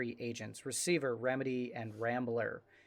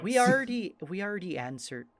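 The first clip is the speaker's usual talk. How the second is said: fighting an Irish slip; 7 semitones higher, 6 dB louder than usual. Acted. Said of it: "not so easy not to slip into Irish".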